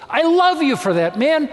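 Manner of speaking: high, emphatic outburst of love; exuberant affection. Acted. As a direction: burst out with hearty approval. Voicing God's delighted praise.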